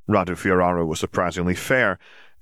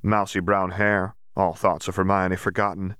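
plain reading voice

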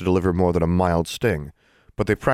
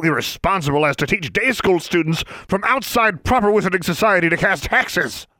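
one word roared much higher and louder; bellowing Mister Brown; 13 semitones higher, 4 dB louder than usual. A narrator's reading. Outraged official bellows at the children. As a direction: explode with the word Duel.

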